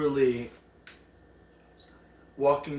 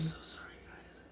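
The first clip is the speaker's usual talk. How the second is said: unvoiced ASMR whisper apology; 16 dB quieter than usual. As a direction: breathe the apology, no voice.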